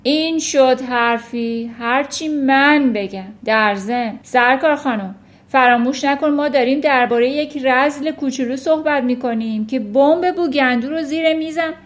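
loud, domineering rebuke; commanding shout. A narrator's reading. Headmistress reminding teacher about little villain, shouting. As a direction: shout to assert who is boss. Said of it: "فریاد کشید".

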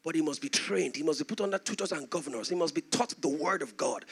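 ordinary delivery

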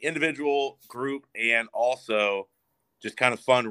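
usual speaking voice

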